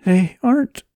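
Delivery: high, tearful, broken-off words; choked by sobs. A narrator's reading. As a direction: start high and choke off before finishing. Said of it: "More sobs choked off her words".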